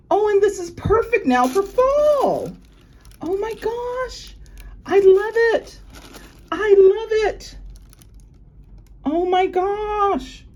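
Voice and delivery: high, loud, drawn-out delight; delighted squealing. Real, not acted. Vlogger adoring her birthday present, high delighted squeals.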